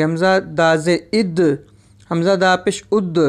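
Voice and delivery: "इद", "उद" with a bounced, echoing d; qalqala bounce. Shown as a demonstration. Teacher demonstrates qalqala on daal, bouncing "इद" and "उद". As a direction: release the final d of "इद" and "उद" with a bounce. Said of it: "इसको हम हलाका पढ़ेंगे".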